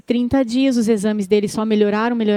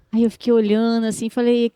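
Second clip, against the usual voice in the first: usual speaking voice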